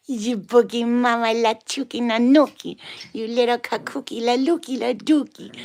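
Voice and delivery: high, loud silly pet babble. Real, not acted. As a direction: babble playfully at your puppy. Nonsense baby talk to the dog.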